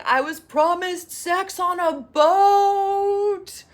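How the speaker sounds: high, loud, drawn-out mock lament; hammed-up despairing cry; mock crying wail. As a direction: wail it like a melodramatic man on his knees. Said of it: "is literally crying and falling to his knees".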